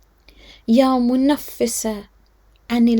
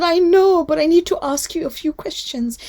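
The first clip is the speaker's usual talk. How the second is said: put-on pleading little girl; 5 semitones higher, 6 dB louder than usual. Little Sakina pleading with father.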